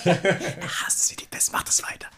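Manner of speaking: whispered through a giggle; a teasing whisper, still laughing. Real, not acted. Teasing aside about the DM.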